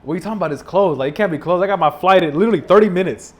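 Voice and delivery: half-mock panic at a closed desk; retelling a panicked airport outburst; panicked disbelief. Panicking at a closed airline kiosk.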